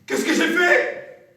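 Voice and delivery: slow, loud, high horrified cry. Acted. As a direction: recoil in horror. Professor horrified.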